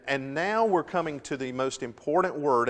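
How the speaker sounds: ordinary talk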